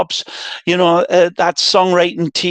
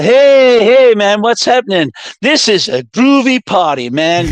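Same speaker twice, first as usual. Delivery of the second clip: bad American accent; 3 semitones higher than usual. Imitating the ballplayers on the record, bad American accent. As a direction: call out groovily in a poor American accent. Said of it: "that's my worst American accent".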